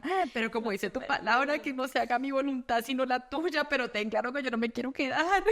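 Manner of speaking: high-pitched imitation of tearful praying; mock crying. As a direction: wail the plea lightly, ending in a laugh. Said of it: "como dice, yo llorando".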